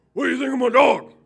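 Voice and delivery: high, loud, fast proud question; loud neighbor. Retold or imitated. Mister Cook hollering to the minister about his dog, high and loud. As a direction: shout it out proudly. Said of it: "Mister Cook says".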